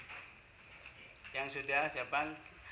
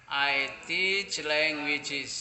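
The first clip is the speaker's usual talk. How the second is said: very slow, louder classroom English; slow dictation to a class; slow model sentence; 5 dB louder, much slower than usual. Shown as a demonstration. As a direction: draw out every word for learners.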